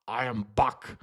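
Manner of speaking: put-on low German-accented action-robot voice; Arnold Schwarzenegger's Terminator. Streamer retrying to prove they can do better, low Terminator impression. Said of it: "I can do a German accent in English".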